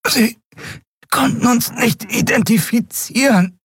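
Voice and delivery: high, strangled voice; choked. A narrator's reading. Endriel explaining while strangled, high choked voice. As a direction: squeeze the words out breathlessly. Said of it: "brachte sie erstickt hervor".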